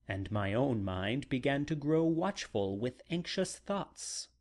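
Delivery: plain reading voice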